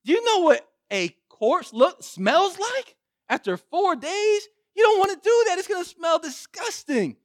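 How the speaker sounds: high, loud, incredulous protest; disgusted mourners. Retold or imitated. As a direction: mourners protesting to Jesus about opening the tomb. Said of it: "They said".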